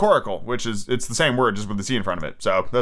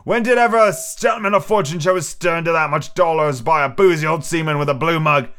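louder pirate bluster, higher; defiant cry; 6 semitones higher, 4 dB louder than usual. A narrator's reading. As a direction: roar down his own fear. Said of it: "he cried".

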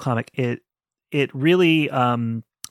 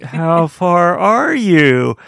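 slow, louder, drawn-out teasing question; mock nosy asker; 3 semitones higher, 6 dB louder, much slower than usual. Imitating people who asked how far she'd read, slow, louder, drawn out. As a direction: stretch it out like a pushy spoiler fan.

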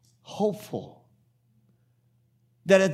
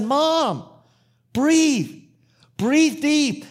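high, raised urgent plea; urgent exhortation; 10 semitones higher, 5 dB louder, much slower than usual. Retold or imitated. Son tells chattering mother to breathe, urgent exhortation. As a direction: call it out, word by word, to cut through her chatter.

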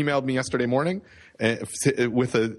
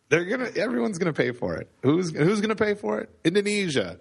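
mock rally speaker, raised pitch; Trump; 7 semitones higher than usual. Joking with co-host about paying for a wall, mock Trump. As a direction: play a hammed-up politician working a crowd. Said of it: "I feel like I just turned into Trump".

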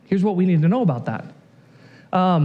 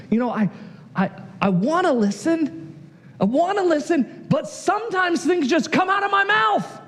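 put-on pleading child; 7 semitones higher than usual. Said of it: "I would tell the teacher, I'd tell my parents".

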